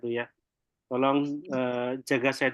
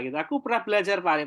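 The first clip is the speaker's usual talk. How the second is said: ordinary talk, nothing marked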